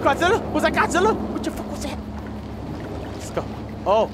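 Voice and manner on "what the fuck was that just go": breathless whispered swearing; shaken whisper. Real, not acted. Gamer rattled by the creature, whispering. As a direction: whisper it, still spooked. Spooked after a scare.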